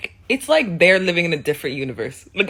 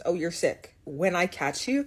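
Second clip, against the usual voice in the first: normal talking voice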